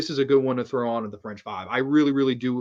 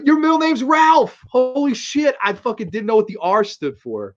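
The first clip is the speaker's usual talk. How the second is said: much higher and louder, amazed; excited yell; 9 semitones higher, 6 dB louder than usual. Real, not acted. Teasing best friend about his middle name, excited yell. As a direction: shout it in happy disbelief.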